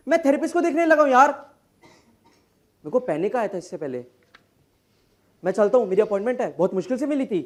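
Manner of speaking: very fast, anxious babble; rushed rant. Acted. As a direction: rattle it off without a breath. Stressed lawyer rattling on. (तनाव में तेज़ी से बड़बड़ाते हुए)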